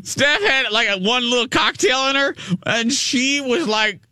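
high giddy gossip voice; excited high retelling. Real, not acted. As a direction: gush about Steph, high and bright.

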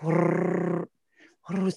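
trilled motor noise; imitated throttle whirr. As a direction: purr like a feathered throttle.

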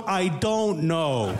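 put-on slow, shrugging mom with a Vietnamese accent; mock Vietnamese mom. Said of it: "And she goes".

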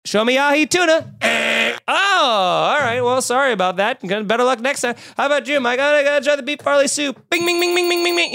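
mock game show host buzzing a wrong answer. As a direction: ham it up like a TV quiz host. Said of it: "like we're on a game show".

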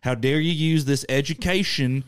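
high, accusing mock Southern zealot; Southern accent. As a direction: denounce them in a hammed-up Southern accent. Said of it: "always a Southern accent we use".

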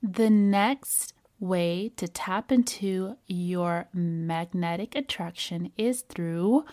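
ordinary talk

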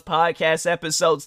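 usual speaking voice

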